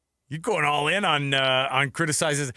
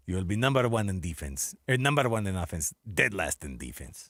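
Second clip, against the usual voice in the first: Eastern European psychic lady voice; 6 semitones lower, 4 dB quieter than usual. Retold or imitated. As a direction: play the spooky psychic, dragging the end. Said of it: "that was my Eastern European, uh, psychic lady voice".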